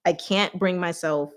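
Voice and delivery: ordinary talk, nothing marked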